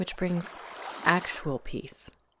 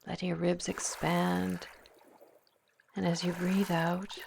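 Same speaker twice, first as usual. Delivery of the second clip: guided meditation; 5 dB quieter than usual. Acted. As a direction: ease the listener into calm, one breath at a time. Leads a guided visualization.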